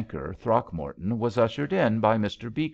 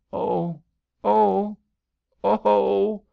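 high, loud wailing oh; a girl's rising wail of grief; 11 semitones higher, 6 dB louder, much slower than usual. A narrator's reading. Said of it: "wailed Billy".

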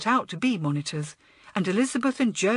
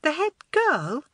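high-pitched incredulous question; disbelief; 9 semitones higher, 3 dB louder than usual. A narrator's reading. Girl hears who took boy's present, disbelieving. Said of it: "stared at him in disbelief".

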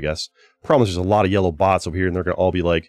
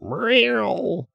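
imitating cat; 12 semitones higher than usual. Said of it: "having a cat fight outside".

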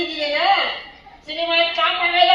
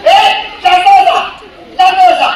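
loud, high shout to silence the crowd; 5 semitones higher, 9 dB louder than usual. Acted. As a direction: yell over the noise to settle them. He shouts for quiet.

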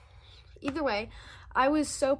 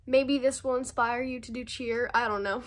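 ordinary talk

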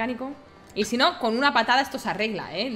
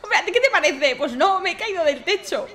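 higher, louder, laughing through it; 8 semitones higher, 7 dB louder than usual. Acted. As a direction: talk while cracking up. Answering while laughing after fall.

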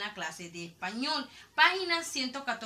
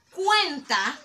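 cuenta hit loud on the first syllable; slow, punched cuenta; emphasis on CUENTA; 5 semitones higher, 7 dB louder than usual. Shown as a demonstration. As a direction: lean hard on CUEN in cuenta. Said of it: "hago la mayor fuerza de voz".

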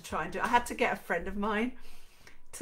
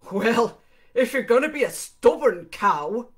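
louder, impatient Irishman; losing patience; 5 semitones higher, 15 dB louder than usual. Acted. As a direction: snap at her as your patience runs out. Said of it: "sounding less patient now".

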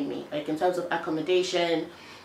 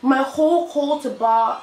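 high and loud, dragged out slowly; frustrated rant; 6 semitones higher, 4 dB louder, slower than usual. Real, not acted. Telling viewers about the call to bar process, a high, loud, fed-up rant. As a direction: let the frustration spill over.

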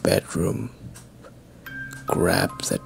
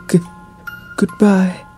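higher, louder stammered farewell; frightened stammer; 13 semitones higher, 6 dB louder than usual. A narrator's reading. Scared girl bolting from her friend's house, frightened high stammer. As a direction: stumble on the first sound, then blurt it out high.

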